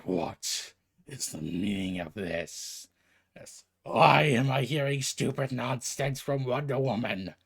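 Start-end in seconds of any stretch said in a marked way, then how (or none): 0.0-2.9 deep slow god of the underworld demanding; low, slow booming lord of the dead; Hades voice. Acted. Hades voiced in retold scene.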